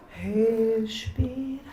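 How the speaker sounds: drawn-out soft breathe cue; slow her breathing down gently; teacher soothing a crying student, soft and slow; soft slow calming. Acted.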